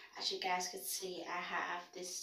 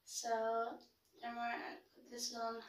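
applying lip balm while talking; 4 semitones higher than usual. Real, not acted. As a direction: stick against lips. Seen 0: Yellow balm stick pressed on lips while she speaks.

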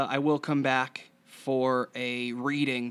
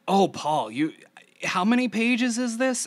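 mock-incredulous friend, pitched much higher and louder; mimicking a friend; 9 semitones higher, 7 dB louder than usual. Mimicking a friend asking how long his script is, higher and louder. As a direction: ham up a friend balking at a script. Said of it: "a lot of my friends".

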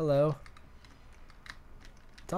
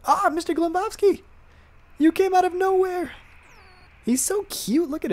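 much higher and louder, gushing over the cat; delighted squeal; 8 dB louder than usual. Real, not acted. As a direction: squeal with joy at finding the cat. Lost cat suddenly reappears.

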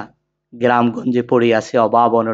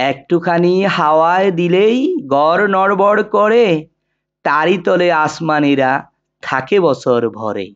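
poem recitation. Shown as a demonstration. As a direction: recite it line by line, sustained and rhythmic, with long pauses between couplets. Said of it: "এখন কবিতাটি আবৃত্তি করছি".

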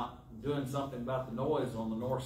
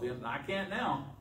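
ordinary talk

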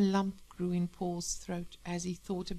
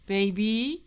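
louder, higher pleading call; desperate; 4 semitones higher, 7 dB louder than usual. Acted. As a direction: appeal to her urgently. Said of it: "said the man desperately".

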